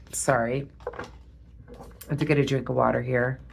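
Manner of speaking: quiet, interrupted by hiccups. Real, not acted. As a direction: talk between hiccups. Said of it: "I got the hiccups".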